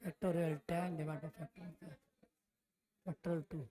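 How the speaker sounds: very soft, slow and low; murmuring to self. Real, not acted. Teacher working out the factorial formula, murmuring to self. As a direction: say it under your breath, drawn out.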